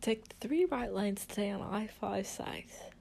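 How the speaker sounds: soft, slow, poor Australian attempt; bad Australian accent. Mimicking the satnav's directions, bad Australian accent. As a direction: murmur directions in shaky Aussie vowels. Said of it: "My accents are shit".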